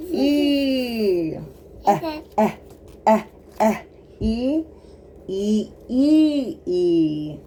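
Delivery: E and "eh" sung out high and loud; teacherly E and "eh", high and bright; letter E sounds. Shown as a demonstration. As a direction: sound out E for a small child. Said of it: "that's the long E sound".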